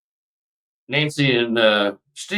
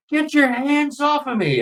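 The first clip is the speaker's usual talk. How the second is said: much higher, panicky cry with little extra volume; mock terror, pitched way up; retold panicked scream. Under hypnosis, reliving a scare, much higher frightened cry. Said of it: "I'm screaming".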